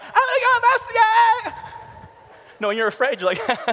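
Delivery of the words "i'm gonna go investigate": high, loud, slow mock falsetto; hammed-up horror victim singsong; horror movie girl. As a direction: parody the clueless girl calling out bravely. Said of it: "you're not like".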